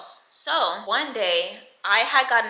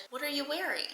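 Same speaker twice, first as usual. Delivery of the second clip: quiet, higher-pitched probing question; hushed suspicious question; 7 semitones higher, 11 dB quieter, faster than usual. Retold or imitated. Charlotte questions the child's homemade skirt, hushed and suspicious. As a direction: ask it softly, with disapproval held back. Stepmother confronts child over outfit.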